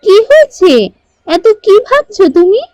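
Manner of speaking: concerned little daughter. A narrator's reading. Daughter Tuktuki asking her worried father, high and concerned. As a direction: ask your father gently what's wrong. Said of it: "বাবাকে এত চিন্তিত দেখে".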